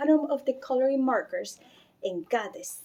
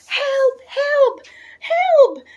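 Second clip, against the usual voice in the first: each help higher and louder, frantic; panicked cries for help; 13 semitones higher, 10 dB louder, much slower than usual. A narrator's reading. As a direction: scream for help down the phone. Mother calls doctor in panic.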